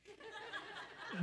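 airy silent laugh, no voice; breathy high laugh. Real, not acted. Husband laughing at wife's speechless stare, airy laugh.

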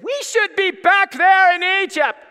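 loud, very high mock complaint; hammed-up wailing crowd of Israelites; whining Israelites. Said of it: "that's probably how they talk".

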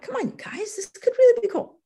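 high-pitched pleading with the team. Retold or imitated. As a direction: re-enact an excited pitch-up, coaxing. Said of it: "My voice went up".

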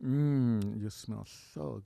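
low, slow creep voice; creepy low murmur. Shown as a demonstration. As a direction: drop very low and soft, slow. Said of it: "How would you do that?".

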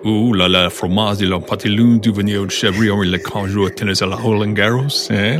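hammed-up French accent gibberish; french chef. Mock French gibberish riff.

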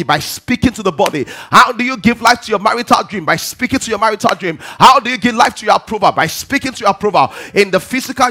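loud, high preacher's cadence; preaching at full force. Acted. Preacher driving the point home to the church, full force. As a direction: preach it out to the congregation. Preaching opening in call pattern.

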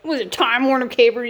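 ordinary talk, nothing marked

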